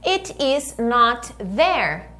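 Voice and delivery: slow, careful model phrase for the class; slow model sentence. Shown as a demonstration. Teacher modelling a sentence slowly for repetition. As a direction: spell it out slowly for students to repeat. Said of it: "bora lá, repeat".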